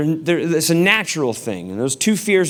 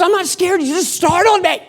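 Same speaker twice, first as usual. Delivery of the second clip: high-pitched imitation of a rattled wife; mock indignant protest, pitched up; wife's defensive retort. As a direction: insist it in a put-on high voice. Wife argues back, acted.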